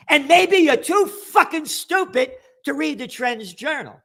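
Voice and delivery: furious rant against lockdown politicians. Real, not acted. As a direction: tear into them, spitting each word out. Angry rant at politicians and journalist.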